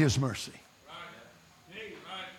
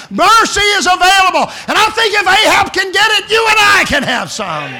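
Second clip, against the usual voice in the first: loud, higher ringing proclamation; preaching; 14 semitones higher, 9 dB louder than usual. Acted. Preacher proclaiming mercy to the congregation. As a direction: proclaim it triumphantly.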